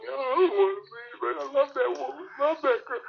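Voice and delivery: high, pleading, tearful mock whine; imitating crying friend. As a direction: act out a heartbroken man begging to stay. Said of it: "This dude is literally breaking down".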